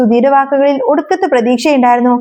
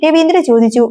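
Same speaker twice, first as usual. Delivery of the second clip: plain reading voice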